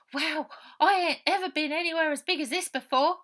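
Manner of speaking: put-on high, loud child's wonder; a boy gasping at the big city; amazed boy. Robert amazed by big town.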